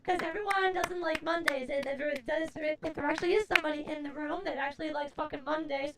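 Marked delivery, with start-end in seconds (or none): none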